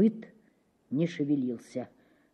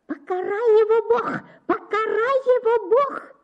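very high shriek through the house; aunt shrieking a curse, very high. A narrator's reading. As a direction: screech a curse. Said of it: "визжала тётка на весь дом".

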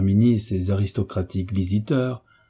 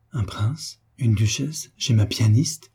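ordinary delivery, nothing marked

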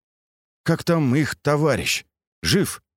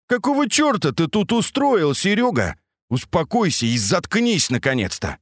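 higher, angry yelling; angry shout; 8 semitones higher than usual. A narrator's reading. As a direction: snap at him to shut up. Max yells at Sergei.

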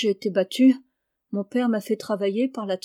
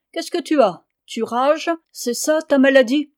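higher, louder mocking jab at the boy; 5 semitones higher, 6 dB louder than usual. A narrator's reading. Father mocks his pale son, higher and louder taunt. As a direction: sneer it at him, higher and louder. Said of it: "dit d'un ton moqueur".

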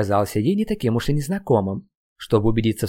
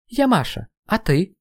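put-on higher, playful girl voice; light girl voice; 9 semitones higher than usual. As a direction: sound like a young girl meeting a boy. Girl introduces herself.

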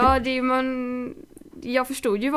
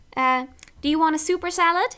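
American waitress voice; 6 semitones higher, 4 dB louder than usual. Retold or imitated.

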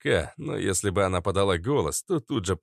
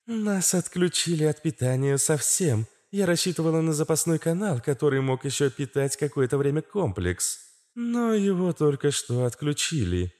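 lightly voiced higher, guilty female voice; apologetic; 6 semitones higher than usual. A narrator's reading. Astra admits the power is cut, mildly apologetic high voice. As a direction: admit it guiltily in a raised register. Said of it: "виновато сообщила Астра".

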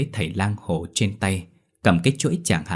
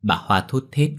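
plain reading voice

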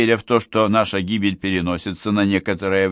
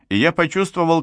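plain reading voice

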